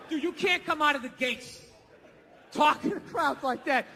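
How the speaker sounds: ordinary talk, nothing marked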